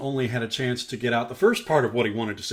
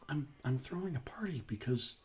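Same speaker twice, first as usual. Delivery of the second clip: whispered; 2 semitones higher, 9 dB quieter than usual. Acted. Father speaking, voiced whisper.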